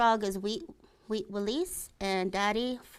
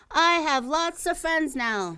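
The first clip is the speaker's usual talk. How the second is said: high, loud echo from across the pond; echo answering; 7 semitones higher, 5 dB louder than usual. A narrator's reading. The echo answering Little Beaver from across the pond, loud. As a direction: call it back like an echo. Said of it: "the voice answered him".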